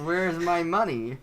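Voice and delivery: high, drawn-out mock complaint; hammed-up plea for payment; mock demand. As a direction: demand it slowly, playing aggrieved.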